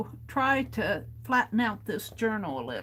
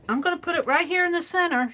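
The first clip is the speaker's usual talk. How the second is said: excited; 8 semitones higher, 6 dB louder, much faster than usual. Real, not acted. Repeats her plan, louder and brighter.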